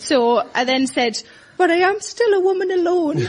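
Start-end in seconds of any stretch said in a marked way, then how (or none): 1.6-3.2 put-on frail little old lady plea, a touch higher; lightly put-on old woman voice; pensioner voice. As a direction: play the helpless pensioner for sympathy. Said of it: "in my best pensioner voice".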